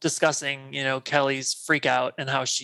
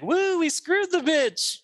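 much higher, slow triumphant cheer; gleeful whoop; 13 semitones higher, slower than usual. Retold or imitated. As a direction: whoop it out in victory. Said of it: "then she's like".

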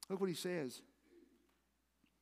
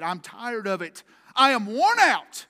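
slow, loud, building to a shout; exasperated outburst; 4 semitones higher, 14 dB louder, slower than usual. Retold or imitated. Preacher acting a worn-out David, slow building exasperation. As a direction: drag it out, getting louder to the end.

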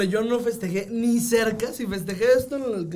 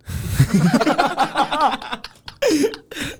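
loud, high laughing fit; helpless long peal of laughter; crying with laughter; 6 semitones higher, 5 dB louder than usual. Real, not acted. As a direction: laugh till you cry, pitch climbing.

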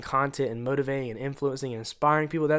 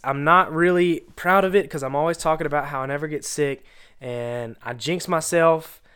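usual speaking voice

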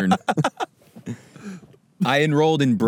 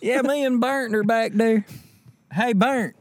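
put-on Southern accent; 11 semitones higher than usual. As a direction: play a goofy Southern guy. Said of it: "Southern guy saying Bert".

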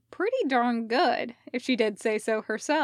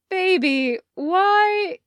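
baffled Hardison; 9 semitones higher, 8 dB louder, much slower than usual. Acted.

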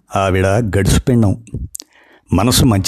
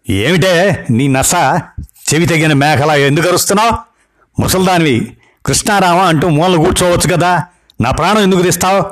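angry outburst; 7 semitones higher than usual. Acted. Grandson snaps at scolding grandma.

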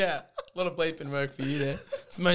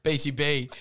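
ordinary talk, nothing marked

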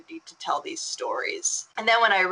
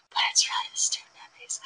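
conspiratorial whisper; whispered aside; 5 dB quieter, faster than usual. Real, not acted. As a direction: whisper it as a side note. Joking aside about the award name.

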